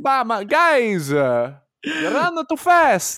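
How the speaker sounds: high-pitched exaggerated Italian accent. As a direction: imitate a player with a high, slow Italian accent. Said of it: "he talks like that".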